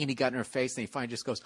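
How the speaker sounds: ordinary talk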